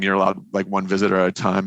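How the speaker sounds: ordinary delivery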